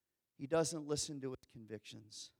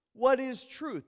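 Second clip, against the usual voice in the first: much higher, louder demand; loud challenge; 10 semitones higher, 10 dB louder than usual. Acted. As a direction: throw the question out loud. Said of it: "And Pilate says to him".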